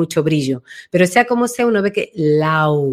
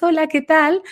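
greeting pitched up high and thin; high, disconnected voice; 7 semitones higher than usual. Shown as a demonstration. Voice coach showing a client's high disconnected voice. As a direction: chirp it up high, as if nobody's home. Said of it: "muy altas, muy agudas".

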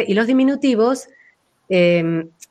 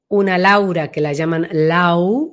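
"Lau" drawn out slowly and sunk inward; slow, inward-placed "Lau"; voice going inward; 3 semitones lower than usual. Shown as a demonstration. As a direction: let "Lau" fall back inside, slowly. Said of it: "va más hacia adentro".

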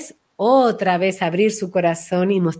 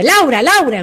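mock angry calling; 6 semitones higher, 4 dB louder than usual.

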